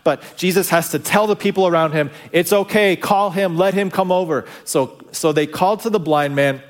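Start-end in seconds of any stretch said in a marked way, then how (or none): 2.3-4.5 calling over the crowd. Retold or imitated. Jesus tells crowd to call him.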